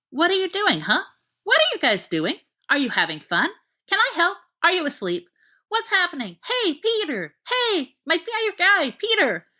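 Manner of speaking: high, bouncy puppy chatter; excited puppy. Acted. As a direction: bounce like an overexcited dog. Sirius as dog, excited.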